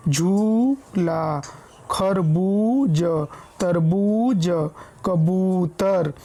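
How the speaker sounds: slow झूला, खरबूज, तरबूज, कबूतर with ū stretched; long ū held with pressure. Shown as a demonstration. Teacher showing that big ū takes time, each word drawn out. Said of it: "उसमें अधिक समय लग".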